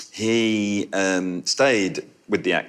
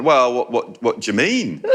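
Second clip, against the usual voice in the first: higher, louder baffled Yorkshire protest; put-on Yorkshire accent; 6 semitones higher, 4 dB louder than usual. Actor challenged by the shop assistant, playing innocent in Yorkshire accent. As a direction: imitate an actor holding his Yorkshire accent. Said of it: "didn't come out of the accent".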